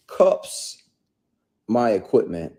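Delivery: usual speaking voice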